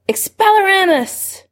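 high, drawn-out cry of a spell; high-pitched shouted incantation. A narrator's reading. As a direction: call the incantation out high, stretching it. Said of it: "James shouted".

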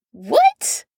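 a startled high squawk. A narrator's reading. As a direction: blurt it out shocked. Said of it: "he squawked".

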